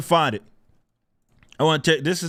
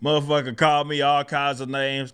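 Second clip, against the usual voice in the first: ordinary talk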